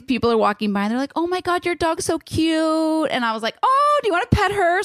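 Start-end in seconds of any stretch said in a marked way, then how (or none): 1.2-3.1 high, slow, cooing over the dog; gushing stranger. Retold or imitated. Passerby gushing over the cute dog, high cooing voice. 3.6-4.8 much higher, eager and sing-song; bubbly offer. Retold or imitated. Owner eagerly inviting strangers to pet her dog, high and bubbly.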